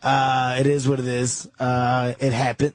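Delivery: deep, laughing low voice of a calm friend; Patrice impression. Imitating Patrice calmly telling the guys to move on, deep. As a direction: drop low and shrug it off with a chuckle. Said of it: "this is what makes Patrice Patrice".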